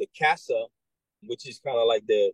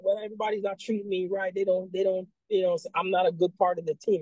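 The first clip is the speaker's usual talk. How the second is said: put-on higher, complaining kid voice; whiny recruit; 7 semitones higher than usual. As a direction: pitch it up like a sulky new soldier. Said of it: "and they're like".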